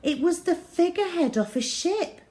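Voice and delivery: ordinary delivery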